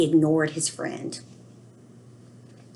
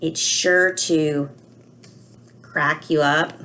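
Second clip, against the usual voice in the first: slow idiom read; 2 semitones lower, slower than usual. Shown as a demonstration. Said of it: "what's the idiom on that page".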